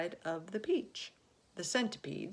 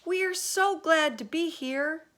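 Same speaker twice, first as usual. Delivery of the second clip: fast, high, loud reassurance; calling out; 12 semitones higher, 11 dB louder than usual. A narrator's reading. James calls down to reassure the men, high and loud. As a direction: call down to calm them. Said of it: "James called out".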